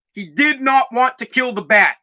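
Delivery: usual speaking voice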